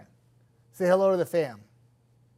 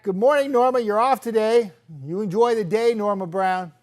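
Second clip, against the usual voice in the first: high, loud, beaming hello; bright loud reading of a viewer's hello; cheerful greeting; 8 semitones higher, 10 dB louder than usual. Retold or imitated. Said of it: "Zoe says hi back".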